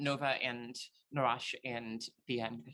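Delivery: ordinary talk, nothing marked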